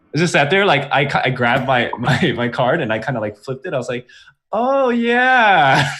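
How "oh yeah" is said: high, drawn-out awkward oh yeah. Retold or imitated. Retelling the door lady, a high awkward oh yeah. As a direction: squeak it out, caught off guard.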